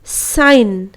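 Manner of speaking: साइन said as sain, g dropped; साइन with no g before n; silent g. Shown as a demonstration. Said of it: "साइजन नहीं पढ़ते हैं बल्कि साइन पढ़ते हैं". (जी के बिना साइन)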